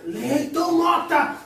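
slow, stern full-name call; scolding by full name. Retold or imitated. Calling by full name, drawn out.

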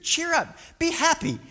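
high-pitched, oddly jolly encouragement; jolly him along incongruously; mock cheering-up. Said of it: "Like this, this weird".

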